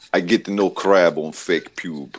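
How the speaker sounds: slow, hammed-up Russian accent, laughing into it; mock Russian accent. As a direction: play a joke Russian, slow and broken. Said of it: "Yeah, Russian".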